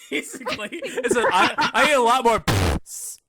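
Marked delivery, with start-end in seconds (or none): 1.1-3.2 laughing through the words; giggling repeat. Retold or imitated. Repeating the misheard line.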